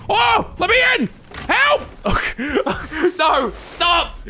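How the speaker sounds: chased by the monster, yelling; panicked shouting. Real, not acted. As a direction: scream at him to stop.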